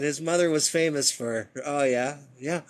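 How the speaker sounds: ordinary delivery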